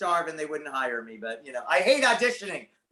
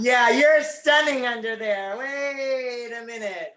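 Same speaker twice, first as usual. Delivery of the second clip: higher, slow, laughing amazement; amazed and laughing; 4 semitones higher, 4 dB louder, slower than usual. Retold or imitated. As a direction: marvel at her, laughing. Amazed reaction to a beauty.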